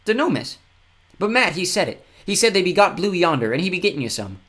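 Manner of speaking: put-on high, earnest little boy voice; a grubby child proudly telling the news; fen tyke. A narrator's reading. Tyke tells Kira.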